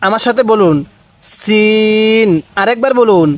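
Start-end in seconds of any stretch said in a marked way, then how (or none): 1.2-2.3 সিন with a thin s and long-held vowel; thin seen. Shown as a demonstration. Teacher has learners read along, thin s in সিন. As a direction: keep the s of সিন thin, then stretch it evenly. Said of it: "সিনের উচ্চারণটা অনেকটাই চিকন".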